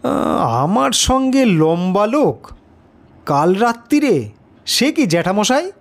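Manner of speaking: asks in plain disbelief; astonished. A narrator's reading. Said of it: "আমি অবাক হয়ে ... বললুম".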